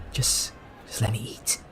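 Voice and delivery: whispered, fed-up plea; weary whisper. Retold or imitated. Voicing the hungry student pleading with the girl, whispered. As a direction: breathe it out, begging to be left alone. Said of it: "he's just like".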